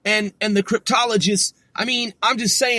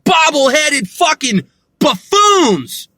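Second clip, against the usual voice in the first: normal talking voice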